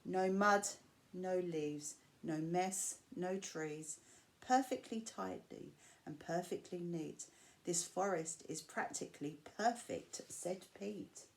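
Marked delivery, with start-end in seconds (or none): none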